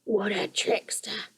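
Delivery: soft, slow creature mutter; a goblin grumbling; muttering creaker. A narrator's reading. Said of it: "said Guff".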